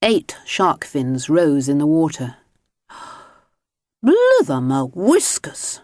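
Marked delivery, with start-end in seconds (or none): 4.0-5.8 mild shout. Acted. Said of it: "shouted Mister Grinling".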